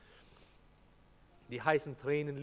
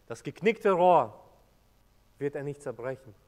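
emphatic; 10 dB louder than usual. Acted. Emphatic scripture quote.

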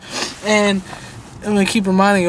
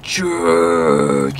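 very low, slow trailer voice; deep movie trailer reveal; dramatic announcer; much slower than usual. Pretend. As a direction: boom the title like a movie trailer.

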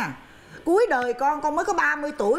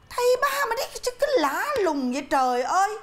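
outraged exclaim; 7 semitones higher than usual. Acted. Mother outraged at the teacher.

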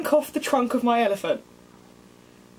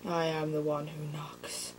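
much lower, much softer, slow menacing growl; Heisenberg impression; 7 semitones lower, 12 dB quieter, slower than usual. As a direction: threaten quietly like a drug kingpin. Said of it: "Best Hugginsburg impression".

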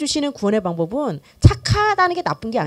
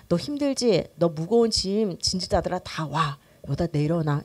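soft, slowing, sinking lower into reassurance; tender invitation; 6 semitones lower, 5 dB quieter than usual. Acted. Jesus inviting the heavy-burdened to rest, tender and soft. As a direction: gently coax the weary to let go.

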